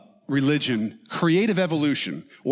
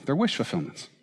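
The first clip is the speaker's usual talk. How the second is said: ordinary talk, nothing marked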